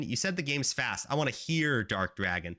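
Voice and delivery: normal talking voice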